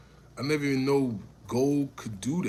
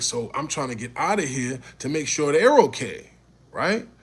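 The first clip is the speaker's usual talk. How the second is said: ordinary talk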